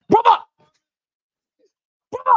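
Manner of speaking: loud phone greeting. Real, not acted. Fan greets rival mate on the phone, loud high call.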